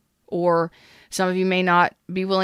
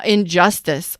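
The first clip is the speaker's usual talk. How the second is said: ordinary talk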